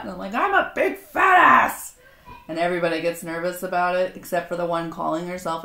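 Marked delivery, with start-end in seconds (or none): 0.3-2.0 loud, high, drawn-out holler; self-mocking shout. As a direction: belt it out high and slow to embarrass everyone. Self-mocking retort acted out loudly.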